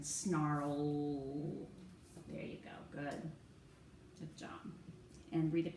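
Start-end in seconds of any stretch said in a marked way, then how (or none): 0.0-1.7 "snarl" stretched slowly, lingering on the L; final sound drawn out. Shown as a demonstration. Teacher asking student for the last sound, final sound drawn out. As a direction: hold the final L of "snarl" so it stands out. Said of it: "What's the last sound that we hear".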